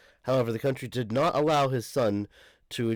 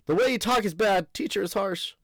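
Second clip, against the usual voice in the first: much higher scolding teacher line; 9 semitones higher than usual. Acted. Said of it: "I can't tell who's fucking talking".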